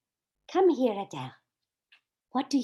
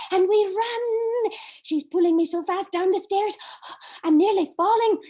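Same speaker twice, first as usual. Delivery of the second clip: higher, louder, rushed excitement; breathless panic; 7 semitones higher, 7 dB louder than usual. Acted. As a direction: gasp it out mid-flight. Running down stairs in panic.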